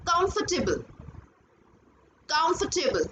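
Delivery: comfortable said as "cumf-tuh-bl", no "for"; correct pronunciation. Shown as a demonstration. Teacher giving the right form: comfortable as "cumf-tuh-bl". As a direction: squeeze comfortable into three syllables, "cumf-tuh-bl". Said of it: "आप नीचे white colour से देख भी सकते हैं".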